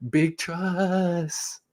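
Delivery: very slow, stretched-out catchphrase; Mark Ingram. Retold or imitated. Echoing the stadium video's catchphrase, drawn-out imitation. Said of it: "reminded of that video of Mark Ingram".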